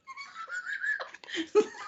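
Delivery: very high-pitched squeal of laughter. Real, not acted. Hosts teasing each other, squealing laugh.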